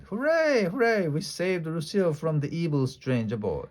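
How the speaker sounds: high cheering, then a proud boast. A narrator's reading. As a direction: celebrate the rescue. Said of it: "we shouted".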